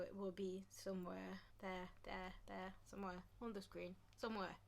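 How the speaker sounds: soft unvoiced murmur; half to herself, whispering; whispered aside. Real, not acted. Vlogger whispering to viewers where the link is. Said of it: "whispered aside about screen link".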